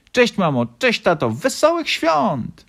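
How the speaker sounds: put-on high, loud child's shout; excited greeting. A narrator's reading. Little fox greeting mum and dad, high excited shout. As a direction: call out, stretching the final wish right up high.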